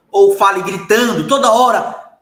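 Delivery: loud, high-pitched shout; sudden shouting demo. Shown as a demonstration. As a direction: burst into a shout to show constant yelling. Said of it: "eu aumentei a voz do nada". (gritando de repente, voz alta)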